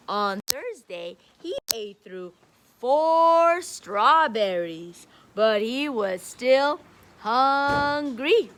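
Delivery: emphatic storybook reading, slow and loud in places; reading to children. A narrator's reading. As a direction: read it out big for a class of kids. Reading picture book to kids.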